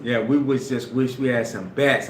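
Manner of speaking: ordinary delivery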